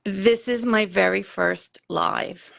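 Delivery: usual speaking voice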